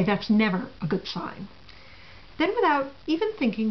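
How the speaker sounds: ordinary talk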